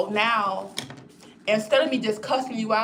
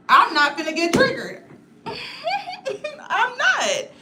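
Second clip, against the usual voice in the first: laughing through it, higher and louder; 13 semitones higher, 3 dB louder, slower than usual. Real, not acted. Telling followers she won't be triggered, laughing. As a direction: say it while cracking up.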